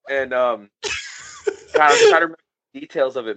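normal talking voice

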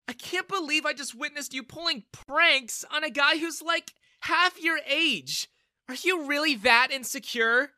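high, loud indignant scolding; angry. A narrator's reading. Daughter shaming her father for his pranks, angry. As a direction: berate him in disbelief. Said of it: "she replied angrily".

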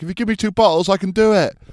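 high, loud shouted reply; shouting back. Retold or imitated. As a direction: holler back across a noisy crowd. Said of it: "I was shouting back".

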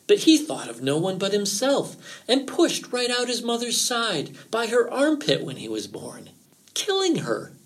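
high, dramatic storytelling voice; dramatic fox, pitch climbing at key moments; ominous fox. A narrator's reading. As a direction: tell the dark tale with swooping emphasis. Fox tells of evil twin.

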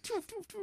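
high popping bullet sounds; mimicking bullets hitting the case, high popping noises; gunfire noises. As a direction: spit out the bullet hits.

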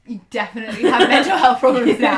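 loud, fast, laughing through it. Real, not acted. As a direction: blurt it out while cracking up. Joking punchline about the pandemic.

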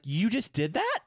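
high disbelieving question; stunned question. Retold or imitated. Boyfriend reacting to the test, high stunned question. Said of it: "I think I was like".

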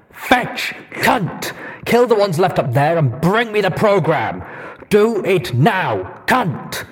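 loud, harsh commanding shouts; barked orders. A narrator's reading. Deus shouting orders at Blackjack. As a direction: snap orders at her.

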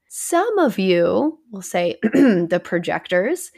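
ordinary delivery

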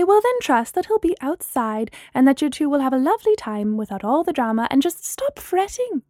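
usual speaking voice

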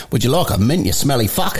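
low, blunt Australian offer. Acted. Australian honestly offering a smelly person a mint, blunt Australian accent. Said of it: "At least in Australia, they're a little bit more honest".

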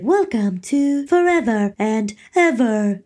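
slow, drawn-out robotic announcement; lightly mimicked robot woman, slow; robot woman quote. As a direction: stretch it like a looping robot voice. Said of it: "så säger hon typ".

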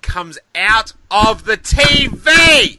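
loud, high, drawn-out exclamation; thrilled climax. Real, not acted. Fan reliving the X-Wing leaving the TV, thrilled shout. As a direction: build slowly to a huge reveal. Climax of the retold trailer memory.

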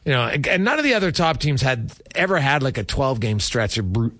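usual speaking voice

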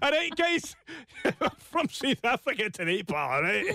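high, hammed-up South African accent gone astray; wrong-country accent. Host clowns after being called Scottish, high silly mis-placed South African accent. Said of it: "slightly different part of the world".

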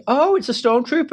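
high mock-delighted dad voice; mimicking granddad pretending to be thrilled; imitating dad. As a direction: pitch way up for dad's fake surprise. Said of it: "And my dad was like".

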